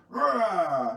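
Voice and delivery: loud, drawn-out pretend roar; wolf's scary roar. Wolf jumping out to scare people, playful roar. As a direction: jump out roaring to scare. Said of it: "he likes to jump out and scare people".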